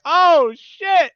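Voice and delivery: very high, loud mock panic; startled yelp. Rider loses his sunglasses, a high startled yelp. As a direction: yelp as the sun blinds you. Sunglasses fly off, sun blinds.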